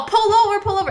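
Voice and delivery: high-pitched mock calling out; urgent, high acted call; retold yell. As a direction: imitate someone hollering from another car. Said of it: "he yells out my name".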